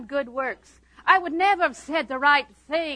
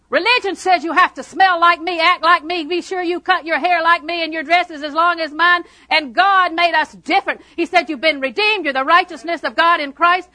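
loud, raised preacher's voice; full-force preaching; 6 semitones higher, 10 dB louder than usual. Real, not acted. Preacher telling the congregation how they were set free, full-force preaching.

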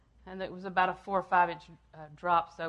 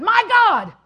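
booming declaration of faith; triumphant proclamation; 2 semitones higher, 15 dB louder, much slower than usual. Acted. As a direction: proclaim it with full force. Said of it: "He said".

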